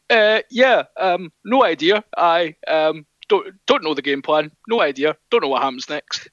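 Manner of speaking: imitating a baffled player, pitch climbing; dopey clueless player. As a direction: play a happily clueless rugby player. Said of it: "it's basically just him going".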